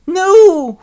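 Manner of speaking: loud, high wailed no; horrified cry. Acted. Orphan told he'll be sold, wailing loudly. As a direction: cry out in melodramatic protest. Oliver cries out when caught.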